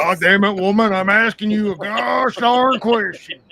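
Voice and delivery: a hint of a blustering bull. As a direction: puff up and bellow like a bull. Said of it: "Where's the bull voice".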